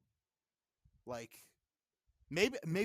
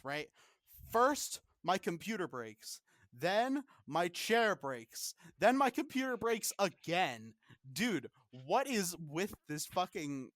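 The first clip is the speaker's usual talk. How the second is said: exasperated anger; 2 semitones higher, 4 dB louder than usual. Real, not acted. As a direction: rant in disbelief, punching key words. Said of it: "I'm so fucking pissed".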